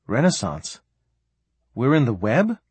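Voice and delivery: puzzled questions, rising at the end; mildly baffled, pausing between questions; bewildered. A narrator's reading. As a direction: ask as if unable to take it in. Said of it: "in total bewilderment".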